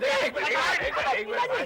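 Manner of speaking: high, loud plea to wait; frantic protest. Acted. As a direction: hold off an attacker in a panic. Stops an attacker mid-fight.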